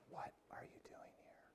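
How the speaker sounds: breathy unvoiced whisper, slowed; hushed whisper of the still small voice. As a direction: whisper it right to one person. Whispered to congregant Matt.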